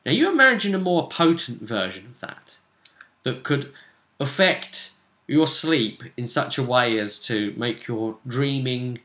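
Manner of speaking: ordinary talk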